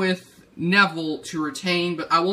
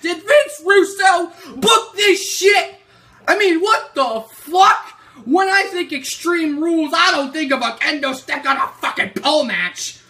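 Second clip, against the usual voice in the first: furious shout; 10 semitones higher, 7 dB louder than usual. Real, not acted. Ranting to viewers about Extreme Rules booking, furious shout. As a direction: shout it at the booker.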